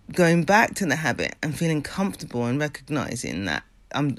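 normal talking voice

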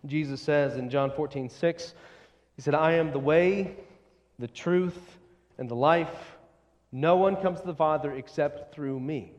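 ordinary talk

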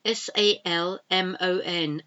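normal talking voice

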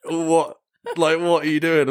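mildly imitated startled neighbour; surprised householder. Neighbour opening door for work, startled. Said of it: "they're like".

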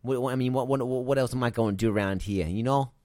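a bad New York accent, keep it going; attempted New York accent. Cohost continuing the New Yorker bit, bad New York accent. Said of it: "Did that sound New Yorkie?".

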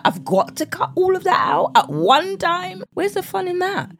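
high-pitched mock protest, stretching it out; exasperated dieter. Voicing a dieter's exasperated complaint.

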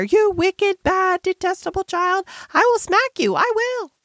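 very high, helpless threatening; excited scolding. A narrator's reading. Miss Amelia threatening the screaming child, excited high-pitched scolding. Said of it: "quite so helplessly and excitedly".

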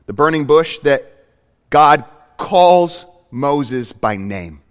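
ordinary delivery

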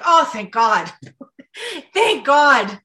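a dying man laughing with relief; relieved laugh. Retold or imitated. Dying man relieved at news, laughing with relief.

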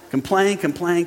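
usual speaking voice

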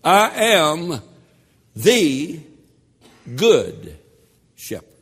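"good" drawn out and falling, each word spaced; slow deliberate stress. Shown as a demonstration. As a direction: slowly weigh every word, land on "good". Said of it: "intentionally said".

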